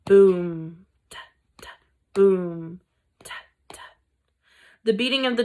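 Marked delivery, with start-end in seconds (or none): 0.1-4.0 low boom then whispered taps; drumbeat. Acted. Narrator imitating the Mouse Council drum. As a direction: sound out the drum rhythm.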